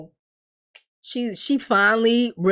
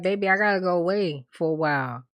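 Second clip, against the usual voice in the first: softly voiced, lower, understated sorrow; retold tearful confession; 5 semitones lower, 4 dB quieter than usual. Man leaving his girl, mildly tearful. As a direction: imitate a man gently breaking bad news. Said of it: "and he's crying".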